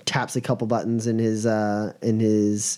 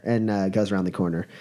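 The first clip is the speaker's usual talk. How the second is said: ordinary delivery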